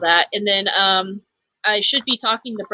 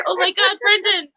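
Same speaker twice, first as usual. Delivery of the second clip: very high-pitched mock squeal, building louder; imitating delighted greeting; 11 semitones higher, 4 dB louder than usual. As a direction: mimic a friend thrilled by the caller. Imitates friend answering Brendan's call.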